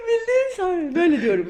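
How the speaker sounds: high, loud, crying with laughter; laughing hard. Real, not acted. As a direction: break up laughing. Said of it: "sarhoş gibi konuşuyorum".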